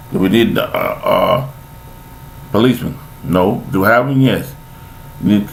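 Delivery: usual speaking voice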